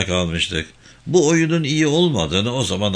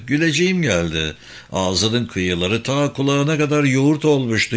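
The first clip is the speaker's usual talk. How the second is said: ordinary delivery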